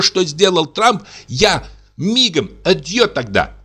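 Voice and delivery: high, heated vow; indignant wife. Acted. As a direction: declare it proudly. Wife vows to leave husband.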